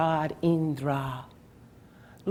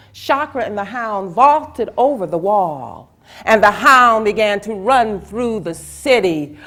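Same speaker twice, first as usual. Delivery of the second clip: usual speaking voice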